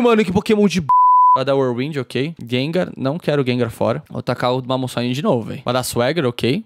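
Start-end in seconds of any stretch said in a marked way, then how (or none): none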